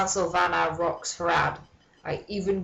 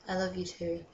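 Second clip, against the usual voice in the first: I love you too, much softer and dropped away; soft repeat; 9 dB quieter than usual. Pretend. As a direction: let it fade to a mock tender hush.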